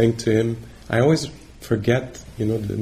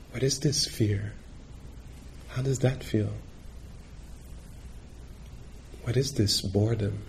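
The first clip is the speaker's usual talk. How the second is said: gently probing questions, much softer; soft inquiring questions; 10 dB quieter than usual. Retold or imitated. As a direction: ask each question quietly, as if looking inward. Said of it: "she would say".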